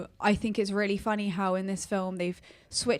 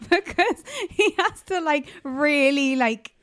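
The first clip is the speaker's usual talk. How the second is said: laughing; 7 semitones higher, 6 dB louder than usual. Real, not acted. Laughing while describing scene.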